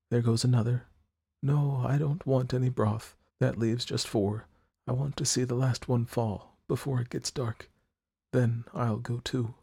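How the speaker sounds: put-on faint, resigned young woman. A narrator's reading. Sick girl to her friend, softly resigned. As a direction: let each word fade out. Johnsy voiced, low and fading.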